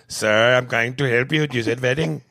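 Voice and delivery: loud put-on Indian accent; mock Indian-accented Siri insisting on helping; exasperated Indian accent impression. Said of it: "have an Indian male accent".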